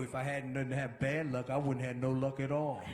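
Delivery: low, downbeat grumble; imitating a hard-luck moaner; mock gloomy complaint. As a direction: sink the voice into self-pity. Imitates negative talkers.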